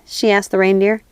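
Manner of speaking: ordinary delivery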